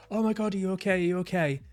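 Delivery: put-on high, alarmed parent fussing over a hurt kid; panicked parent. Parent to a hurt child, high panicked fussing. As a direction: gasp it out like a frightened mum. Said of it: "and the parent goes".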